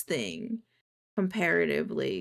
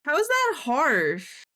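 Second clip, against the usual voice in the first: incredulous; 7 semitones higher, 5 dB louder, slower than usual. Real, not acted. Reacting to chat.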